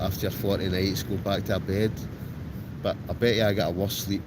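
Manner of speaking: ordinary talk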